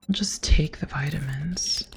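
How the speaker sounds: hushed whisper to self. Real, not acted. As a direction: whisper it like sneaking. Whispering while looting.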